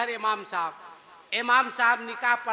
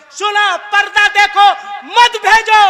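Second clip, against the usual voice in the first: much higher and louder plea; fiery preaching; 9 semitones higher, 15 dB louder than usual. Real, not acted. Preacher urges women to keep purdah, fiery shout. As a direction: thunder the warning to the crowd.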